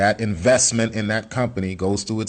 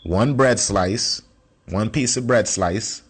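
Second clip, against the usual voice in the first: slow and deliberate; slower than usual. Shown as a demonstration. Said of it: "Remember we was talking about the bread?".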